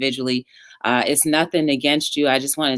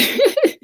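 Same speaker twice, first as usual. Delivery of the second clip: high laugh; 4 dB louder than usual. Real, not acted. Laughing at sign-off.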